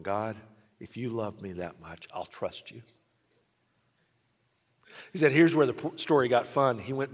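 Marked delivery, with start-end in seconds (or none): 0.0-2.8 much softer, lower, a touch of tears; quiet surrender through tears; boy praying softly while crying; tearful prayer. Retold or imitated. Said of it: "he found himself sitting there crying".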